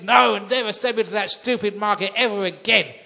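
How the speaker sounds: husband ranting to his wife about the market; furious rant. Acted. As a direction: storm in and vent at full volume. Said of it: "He was so upset".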